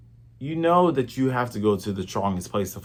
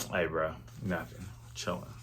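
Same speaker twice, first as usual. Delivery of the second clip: much softer, lower, shrugging tone; mock casual mumble; 6 semitones lower, 15 dB quieter than usual. Zoro brushing off Luffy's question, soft and casual. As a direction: play it off quietly like nothing happened. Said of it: "Zoro's like".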